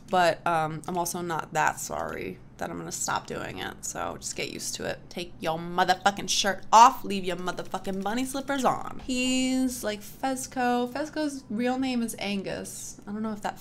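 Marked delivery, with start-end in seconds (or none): none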